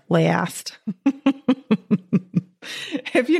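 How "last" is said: "Last" drawn out as "leh-ast", a broad Wisconsin vowel; Wisconsin accent. Shown as a demonstration. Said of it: "I sounded very Wisconsin there".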